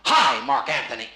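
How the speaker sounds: slow, loud theatrical hail; declaimed, drawn-out hello; stage greeting demo. Shown as a demonstration. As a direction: demonstrate a grand stage greeting. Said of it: "I'm gonna say to you".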